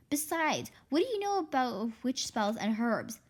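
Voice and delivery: usual speaking voice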